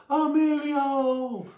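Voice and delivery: high, drawn-out shout of Emilio; quoting a movie, yelling the name. Acted. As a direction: belt out Emilio, long and high.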